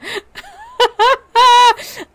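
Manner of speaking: high, loud burst of real laughter; loud laughing fit. Real, not acted. Streamer cracking up at the anonymous letter, loud laugh.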